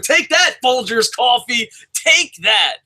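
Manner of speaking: gleeful shouted jab; mock taunt. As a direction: rub it in, shouting. Taunting a coffee brand.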